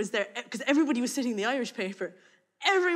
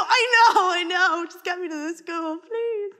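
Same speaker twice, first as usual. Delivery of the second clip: high, frantic pleading; desperate plea; pleading panic; 7 semitones higher, 5 dB louder, slower than usual. Retold or imitated. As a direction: beg to be driven. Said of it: "And I go".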